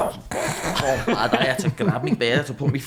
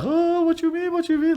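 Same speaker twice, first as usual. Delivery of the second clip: very high mock voice of the laughing boatman; laughing reply. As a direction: brush it off with a high giggly shrug.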